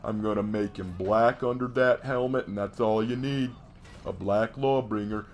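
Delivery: slow, lazy mock pitch; a touch of smug laziness; mock lazy writer. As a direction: parody a lazy writer shrugging it off. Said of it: "I don't just say".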